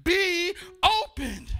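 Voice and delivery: loud, driving sermon delivery; full-force preaching. Real, not acted. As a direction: preach it hard to the congregation.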